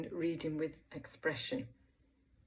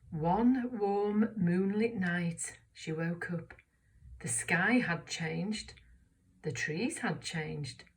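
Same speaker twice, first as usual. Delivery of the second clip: slow wondering reading; slower than usual. Shown as a demonstration. Reading aloud together, slow.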